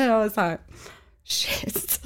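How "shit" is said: breathy shocked whisper; whispered curse. Retold or imitated. Caught out.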